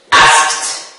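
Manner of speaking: ASKED with final -ed as T; final-ed option. Shown as a demonstration. Teacher quizzing students on the verb, option with T. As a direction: say ASKED as one syllable, askt. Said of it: "pronounce it".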